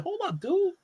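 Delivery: high, soft, cooling-down voice; calm-down reply. Retold or imitated. Answering a shouty text, high and soft calm-down. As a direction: gently tell them to chill.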